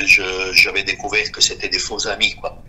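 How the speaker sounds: normal talking voice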